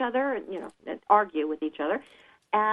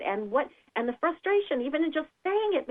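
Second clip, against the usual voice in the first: ordinary talk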